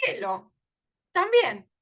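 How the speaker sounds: normal talking voice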